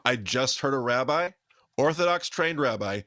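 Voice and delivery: ordinary talk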